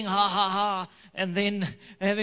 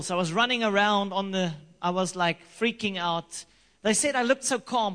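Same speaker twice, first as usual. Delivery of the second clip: normal talking voice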